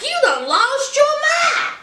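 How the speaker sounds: high, put-on voice of a furious husband; outraged husband.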